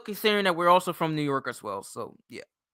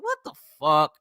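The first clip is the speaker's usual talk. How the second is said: ordinary talk, nothing marked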